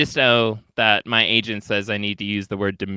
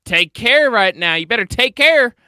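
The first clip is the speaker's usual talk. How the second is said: threatening; 6 semitones higher, 6 dB louder than usual. Retold or imitated. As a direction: lean on care like a warning. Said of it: "it's all about the tone".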